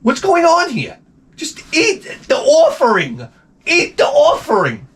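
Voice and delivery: high, exasperated scolding. Retold or imitated. Owner scolding his dog for sniffing turkey, high exasperated. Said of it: "I'm like".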